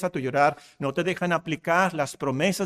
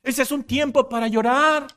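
wanted to yell; 7 semitones higher than usual. Retold or imitated. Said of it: "yo quería gritarle a ella".

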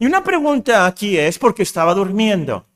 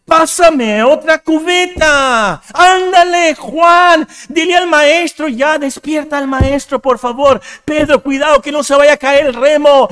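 high, loud, frantic shouting in a storm; shouting disciples; 8 semitones higher, 6 dB louder than usual. Retold or imitated. Disciples shouting to each other in the storm, high and loud. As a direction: yell over the wind to the crew. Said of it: "han de haber estado gritando ahí".

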